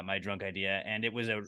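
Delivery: normal talking voice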